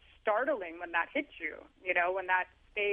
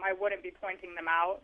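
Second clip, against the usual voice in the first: ordinary delivery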